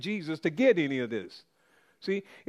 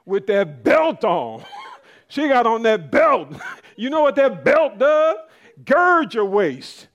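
laughing shouts about her gym belt; loud laughing tease; 3 semitones higher, 9 dB louder than usual. Real, not acted. Preacher laughs loudly teasing the sister about her belt. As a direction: crow with delight at the sister's lifting belt.

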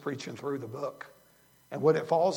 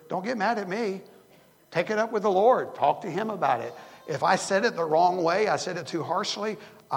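rising, louder pulpit voice; preaching; 3 semitones higher, 5 dB louder than usual. Acted. Pastor urging his congregation not to get offended, preaching loud. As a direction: press it home like a pastor warning his flock.

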